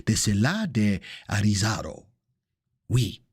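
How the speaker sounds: playful put-on French accent. Shown as a demonstration. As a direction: slip into a mock French accent. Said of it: "I could go into the French accent".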